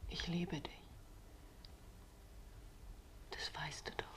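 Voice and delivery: soft intimate whisper. Acted. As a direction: breathe it close to his ear. (zärtliches Flüstern)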